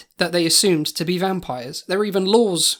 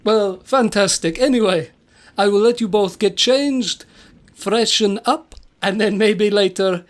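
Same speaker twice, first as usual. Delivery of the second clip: put-on Transylvanian accent. Castle host leaving guests to freshen up, Transylvanian accent. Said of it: "that is a Transylvanian accent".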